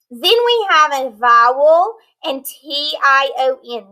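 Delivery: ordinary talk, nothing marked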